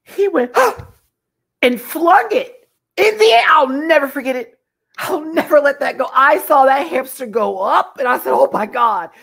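high and loud, rushing the story; excited retelling. Real, not acted. Recalling the flung hamster, loud and high. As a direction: tell it loud and high, still shocked.

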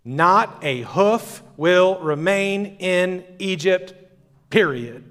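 slow, weighty ruler's pronouncement; stern Pharaoh decree. Retold or imitated. Pharaoh to Moses, slow and final decree. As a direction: lay down the law, word by word. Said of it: "Pharaoh quote".

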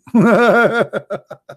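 a loud burst of laughter; amused cackle; real laugh. Real, not acted. Laughing at the translation.

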